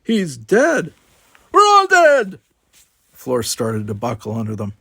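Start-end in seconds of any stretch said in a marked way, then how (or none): 0.0-2.4 very high, loud hysterical cry; panicked scream. A narrator's reading. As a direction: scream it out in terror. Simon panicking.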